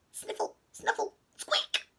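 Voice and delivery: small, high snuffling sound words; sniffly squeaking. A narrator's reading. Storyteller voices someone crying outside, small sniffles. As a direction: make tiny tearful noises.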